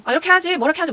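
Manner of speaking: loud, high-pitched mock scolding; 성질내는 흉내. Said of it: "막 승질 내거든요". (목소리 높여 짜증 내는 흉내)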